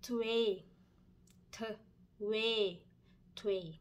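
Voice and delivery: twee said slowly and clearly, Dutch number two. Shown as a demonstration. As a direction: model the word twee for learners. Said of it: "เลขสอง". (ออกเสียง twee ภาษาดัตช์ชัดๆ)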